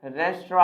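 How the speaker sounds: "रेस्टोरेंट" as res-tro, silent final nt; preferred pronunciation. Shown as a demonstration. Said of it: "क्या बोलेंगे दोस्तों?". ("रेस्टोरेंट" को 'रेस्ट्रो' बोलें)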